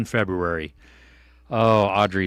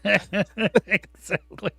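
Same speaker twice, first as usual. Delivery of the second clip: laughing; 9 semitones higher, much slower than usual. Real, not acted. Laughing at the daughter joke.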